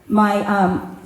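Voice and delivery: ordinary talk, nothing marked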